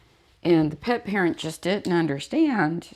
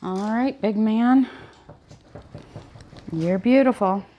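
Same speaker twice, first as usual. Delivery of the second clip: praten tegen de hond; 6 semitones higher than usual. Real, not acted. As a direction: prijs de hond als een kleintje. Talks to the dog.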